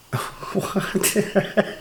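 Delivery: long burst of real laughter. Real, not acted. Laughing hard at what the new cellie heard. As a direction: crack up at the rumor. Told he likes booty.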